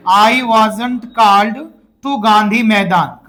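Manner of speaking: very slow, word by word; slow model sentence. Shown as a demonstration. Teacher to his class, dictating the answer very slowly. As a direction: drag each word out for repeating. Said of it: "क्या बोला मैंने?".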